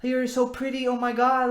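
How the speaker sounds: high, loud, over-eager gushing; hammed-up desperate admirer piling it on; gushing compliment. Mocking the guy who over-compliments a girl, high, loud gushing. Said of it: "that guy that's just like".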